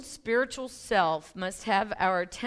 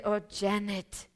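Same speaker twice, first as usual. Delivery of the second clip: put-on Thai accent; slower than usual. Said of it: "he's from Thailand".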